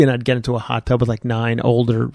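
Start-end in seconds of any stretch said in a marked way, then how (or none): none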